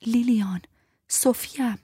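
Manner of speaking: urgent plea. A narrator's reading. Urging dying friend to recognize.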